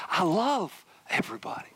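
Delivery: whispered, heartfelt confession; hushed tenderness. Retold or imitated. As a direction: let it drop to a tender whisper. Retold softened heart, whispered.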